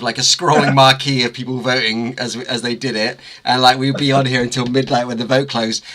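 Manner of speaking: normal talking voice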